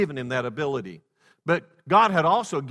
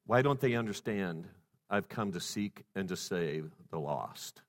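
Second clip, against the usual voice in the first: soft inner voice; 8 semitones lower, 9 dB quieter than usual. Acted. Voicing Jesus' inner thought.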